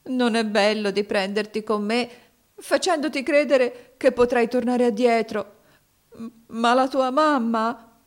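high, loud weeping reproach; acted crying boy's voice; tearful. A narrator's reading. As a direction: plead through tears, pitched well up. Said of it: "egli rispose piangendo".

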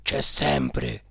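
low, hushed séance voice; medium. A narrator's reading. Cook as medium reporting the spirit's presence, low tone. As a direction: drop the voice low and confirm. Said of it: "disse il medium".

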